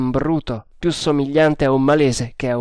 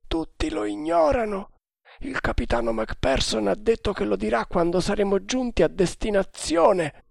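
much higher, hushed Indian sailor; conspirator voice; 8 semitones higher, 4 dB quieter than usual. A narrator's reading. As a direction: whisper like a loyal plotter.

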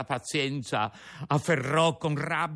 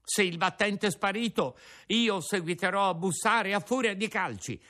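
angry; 9 semitones higher than usual. Acted. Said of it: "accecato dalla collera".

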